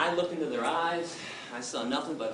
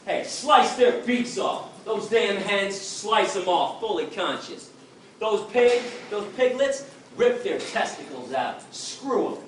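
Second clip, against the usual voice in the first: loud, higher, sneering mock dismissal; callous mockery; 5 semitones higher, 7 dB louder, slower than usual. Mocking those who abuse hens and pigs, loud sarcasm. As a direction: spit it out like a heartless factory boss.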